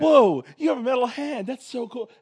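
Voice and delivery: put-on wide-eyed gushing teen voice; excited kid. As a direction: gasp it out like a starstruck kid. Retelling excited Peter Parker.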